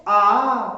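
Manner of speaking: आ held long and loud as a two-beat vowel. Shown as a demonstration. Teacher demonstrating a long vowel, आ drawn out. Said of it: "तो उच्चारण हमने किया आ".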